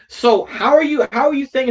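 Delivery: demanding she explain herself; heated retold challenge. Retold angry questioning of girl.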